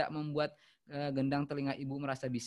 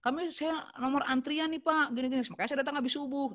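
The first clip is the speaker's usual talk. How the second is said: much higher, louder pushy voice; insistent caller; 10 semitones higher, 3 dB louder than usual. Shown as a demonstration. Caller insisting he came after dawn for a queue number, high and loud. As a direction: push your claim to be first in line.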